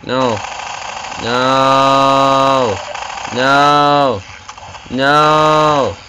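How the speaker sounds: a rising cry of no as he dies; desperate no. Real, not acted.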